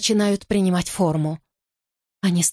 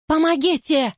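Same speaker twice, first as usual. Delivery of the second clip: high desperate cry for help; terrified scream; 9 semitones higher than usual. Acted. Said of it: "Это кричу я".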